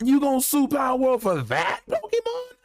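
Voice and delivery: high, incredulous rant; indignant disbelief. Real, not acted. Fan challenging Pokemon for suing Power World, high and incredulous. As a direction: question it in outraged disbelief, pitch way up. Ranting at Pokemon over lawsuit.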